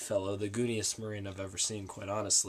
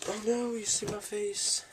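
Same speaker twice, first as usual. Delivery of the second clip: mock alarm; 12 semitones higher than usual. Mask comes off on camera.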